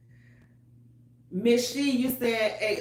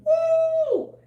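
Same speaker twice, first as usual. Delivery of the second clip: high, loud party whoop; 6 dB louder than usual. Pretend. Whooping to show how loud the family is. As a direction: let out a big excited woo.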